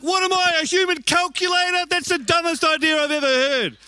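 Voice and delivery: high, loud sarcastic outrage; mock her plan with loud scorn; scornful retort. Husband scoffing loudly at his wife's weighing method. Arguing with wife over ham.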